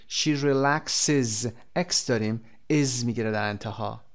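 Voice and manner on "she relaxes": relaxes with a clear final iz sound, spoken slowly; slow drill of relaxes, ending on iz; final es demo. Shown as a demonstration. Teacher drilling students: relaxes with a clearly sounded iz.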